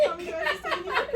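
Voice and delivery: put-on high little-kid voice, laughing; hammed-up child's plea; pleading child. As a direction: play a kid begging mother at the table. Plays child asking mother.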